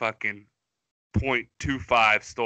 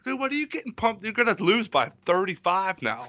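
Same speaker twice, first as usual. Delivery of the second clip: high, incredulous mockery; mocking disbelief; 8 semitones higher than usual. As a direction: scoff at them in a squeaky voice. Mocking pumped-up athletes.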